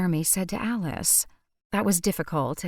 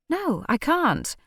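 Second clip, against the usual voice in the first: slow, high-pitched irritated refusal; 7 semitones higher, slower than usual. A narrator's reading. Alice refusing Charlie's plea to come back, irritated. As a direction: draw it out, exasperated. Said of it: "Alice made a sound of irritation".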